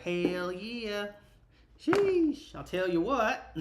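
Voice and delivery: a lightly hammed-up Southern country drawl on hell yeah, sheesh jumping high; country twang. Shown as a demonstration. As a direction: slip into a playful country twang, like when things get crazy. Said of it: "do I have that country twang in my voice".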